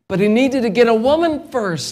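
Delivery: rising pulpit fervor; fervent preaching. Acted. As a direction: preach it out to the congregation. Preaching at full force.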